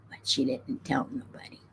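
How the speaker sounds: hushed secretive whisper. Real, not acted. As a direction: lean in and whisper it. Gossipy whispered aside.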